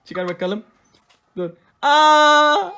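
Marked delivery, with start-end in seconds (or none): 1.8-2.7 high, loud drawn-out "aa"; surprised cry. Real, not acted. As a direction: exclaim sharply at the doll's tears. Doll cries again. (şaşkın, yüksek çığlık)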